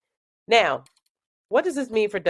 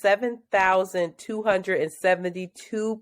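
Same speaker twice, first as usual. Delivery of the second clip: slow careful reading; slower than usual. Real, not acted. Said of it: "some numbers you just read off".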